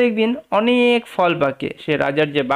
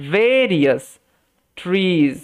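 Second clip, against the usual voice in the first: slow, each word set apart by a long pause; word-by-word reading; much slower than usual. Shown as a demonstration. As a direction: read it out one word at a time. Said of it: "ভেঙে ভেঙে উচ্চারণ করার চেষ্টা করি". (থেমে থেমে ধীরে পড়া)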